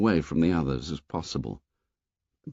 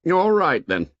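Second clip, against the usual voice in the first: high, loud forced declaration; 8 semitones higher, 4 dB louder than usual. A narrator's reading. Jack speaking loudly to the silent boys after clearing his throat. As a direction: push it out loud to cover the shame. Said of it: "and spoke loudly".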